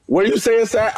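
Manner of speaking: high, disbelieving question; mock disbelief at the caddy's read; incredulous. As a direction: golfer doubting his caddy, high and incredulous. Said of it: "I'm just like".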